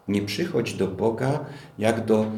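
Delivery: usual speaking voice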